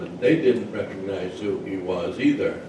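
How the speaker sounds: plain reading voice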